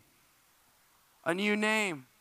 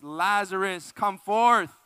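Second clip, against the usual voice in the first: raised voice swelling to a booming summons; loud cry; 8 dB louder, much slower than usual. Acted. Jesus calls dead Lazarus out of the tomb, loud cry. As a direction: call out with a big, commanding shout. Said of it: "cried out in a loud voice".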